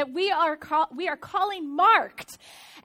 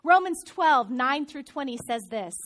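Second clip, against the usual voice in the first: ordinary talk, nothing marked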